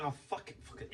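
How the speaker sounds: whispered, soft fuck it; quiet whispered resignation; muttered give-up. Retold or imitated. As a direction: breathe it out under the breath, giving up. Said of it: "I'm like".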